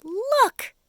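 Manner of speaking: sharp high cry of alarm; startled shout to point something out. Acted. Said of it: "cried the centipede".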